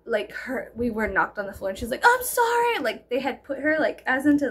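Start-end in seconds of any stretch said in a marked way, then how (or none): 2.0-2.8 1 mock apology. Apologizing girl quoted, high voice.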